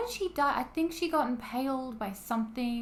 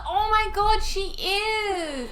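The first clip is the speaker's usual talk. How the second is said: loud, high-pitched outburst; real high shriek of surprise; amazed recognition; 12 semitones higher, 11 dB louder, much slower than usual. Real, not acted. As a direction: exclaim it as the penny drops. Sudden delighted recognition of an actress.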